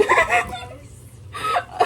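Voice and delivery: high squealing giggle of pure delight; squealing laugh. Real, not acted. Giggling as she opens the fursuit box. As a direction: let a high giggle burst out. Opening her new fursuit.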